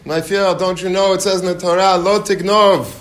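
high, loud mock tirade at the trespasser; shouting 'my field' at an intruder, half in jest; retold angry yell. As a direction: yell it like an irate orchard owner. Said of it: "He's, like, screaming at him".

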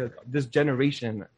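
ordinary talk, nothing marked

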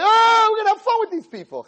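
high-pitched, loud mock delight; mock glee. Hashem mockingly voiced plotting fun.

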